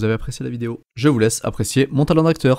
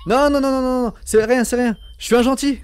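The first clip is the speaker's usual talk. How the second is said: put-on much higher, nervous young hero; high-pitched Spider-Man dub. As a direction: play Andrew Garfield's Spider-Man, reassuring. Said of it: "le moment où du coup, euh, Andrew Garfield arrive".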